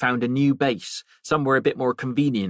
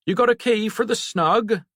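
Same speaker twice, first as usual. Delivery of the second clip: Harry calls over the bar to Jen, a raised lightly voiced landlord; raised, higher landlord's call across the room; 13 semitones higher, 3 dB louder than usual. Harry calls to Jen across the empty pub, raised and higher. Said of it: "he called over to her".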